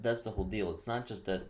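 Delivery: usual speaking voice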